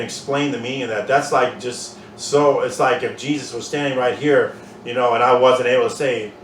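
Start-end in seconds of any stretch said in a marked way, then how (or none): none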